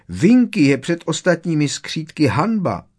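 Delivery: high, loud squeak with big pitch swings; high-pitched indignant elf voice; squeaky indignation. A narrator's reading. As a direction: pipe up like an affronted little elf. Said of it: "zapištěla".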